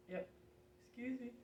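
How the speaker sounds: talking through a yawn; words stretched by a yawn. Real, not acted. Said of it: "I apologize for the yawn there".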